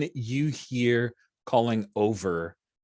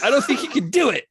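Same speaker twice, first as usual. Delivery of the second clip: laughing; 7 dB louder than usual. Real, not acted. Amused doubt at impossible jump.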